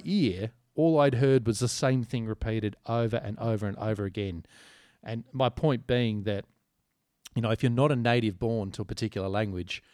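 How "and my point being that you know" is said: ordinary talk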